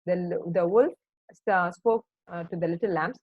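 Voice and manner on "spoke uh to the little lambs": ordinary delivery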